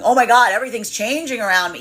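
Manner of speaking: hammed-up panic, voice jumping up; mock terrified. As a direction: blurt it out in alarm at the change. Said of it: "or this could be fearing, feeling terrifying".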